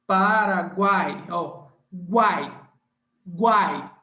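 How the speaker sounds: Paraguai slow and loud, guai in one sound; teacher drills guai loudly; all together. Shown as a demonstration. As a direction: stress guai as one glide. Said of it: "tudo junto".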